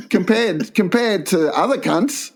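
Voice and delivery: high, laughing self-congratulation; mock-proud inner voice, half laughing; gleeful realisation. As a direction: crow about being fancy with a laugh. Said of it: "and I thought".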